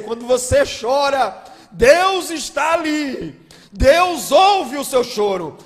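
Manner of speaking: loud, high, fervent preaching; fiery pulpit climax; preaching at full force. Acted. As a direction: build the congregation up, loud and slow.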